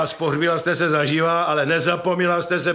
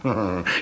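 low sneering chuckle; 9 semitones lower than usual. Acted. He sneers at her act.

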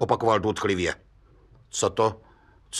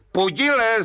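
roaring call; 10 semitones higher than usual. Retold or imitated. Stranger roars at Pudil from the far bank, mock yell. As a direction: roar his name across the river. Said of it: "zařval ten člověk na druhém břehu".

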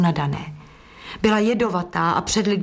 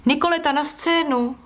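calling out; 7 semitones higher than usual. A narrator's reading. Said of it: "zvolal ze scény".

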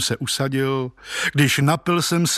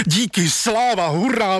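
higher-pitched, mildly shouted hurrah; jubilant cry; 6 semitones higher than usual. A narrator's reading. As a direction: cry out thanks with joyful relief. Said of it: "vykřikl jsem".

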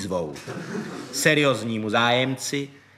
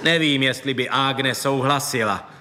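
ordinary delivery, nothing marked

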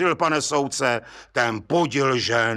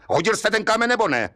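judge bursting out; 10 semitones higher, much faster than usual. A narrator's reading. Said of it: "spustil soudce".

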